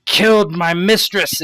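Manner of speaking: high, loud, fast scolding in character; incredulous outrage. Acted. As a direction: snap back as if they're an idiot. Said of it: "like you're the dumbest person she's ever seen".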